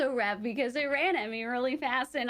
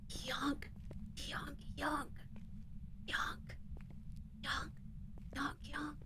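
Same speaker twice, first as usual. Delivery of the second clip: soft whispered yonk, yonk; 4 semitones higher, 13 dB quieter, much slower than usual. Retold or imitated. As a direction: whisper the silly noise with no voice left. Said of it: "I'm pretty sure I whispered".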